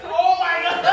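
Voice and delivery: loud, high "oh my God" that breaks into laughter; horrified laughing outburst; panicked laugh. Real, not acted. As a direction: laugh out loud in horror at your own mistake. Panicking over the wrong name.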